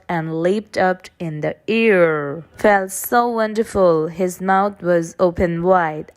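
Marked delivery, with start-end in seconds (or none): none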